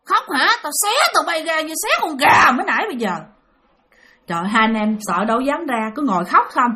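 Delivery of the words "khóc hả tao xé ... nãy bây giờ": high, forceful scolding threat; imitated threatening woman. Woman threatens crying children in hiding, imitated menace. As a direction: screech the order, then threaten. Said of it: "Nói vậy đó".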